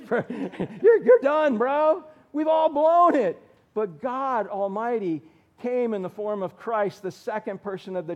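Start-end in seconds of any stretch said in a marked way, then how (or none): 0.1-3.4 laughing teasing. Real, not acted. Pastor teasing a young kid, laughing loudly.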